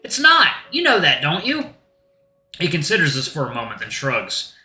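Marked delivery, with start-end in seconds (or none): 0.0-1.7 high, exasperated complaint; indignant protest. Acted. Narrator disputing that wolf giants are less scary, shrill indignation. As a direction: argue back in a worked-up squeal.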